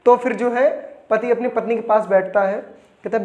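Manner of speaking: ordinary delivery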